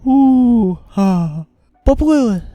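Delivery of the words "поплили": ordinary delivery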